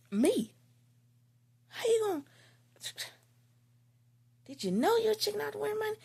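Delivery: high-pitched, outraged confrontation; acted-out indignant rant; mad. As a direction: tear into him in disbelief, pitch way up. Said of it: "Now I'm mad".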